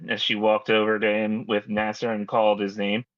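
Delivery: ordinary talk, nothing marked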